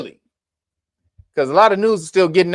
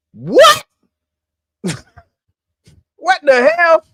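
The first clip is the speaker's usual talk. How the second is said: high, loud yelp of disbelief; astonished outburst; incredulous; 11 semitones higher, 5 dB louder, much slower than usual. Real, not acted. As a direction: shoot the pitch way up, stunned. Disbelief at the payment.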